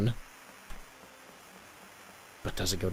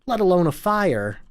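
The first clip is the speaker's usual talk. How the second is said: loud, pained speech raised high; anguished townsman; 5 semitones higher, 7 dB louder than usual. Acted. A desperate husband rails to his wife, loud and anguished. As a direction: rage against those in charge.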